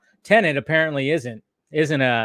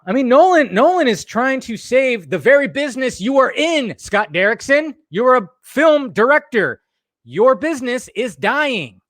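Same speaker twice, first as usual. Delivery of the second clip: high-pitched, emphatic exasperated rant; going off; 8 semitones higher than usual. Real, not acted. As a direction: lay into them, higher and insistent. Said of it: "I'm just kinda going off tonight".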